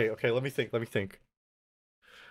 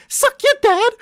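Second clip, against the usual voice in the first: very high, loud mock chav British accent; 8 dB louder than usual. Streamer mocking chav slang for chat, loud squeaky chav accent. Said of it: "they're slang".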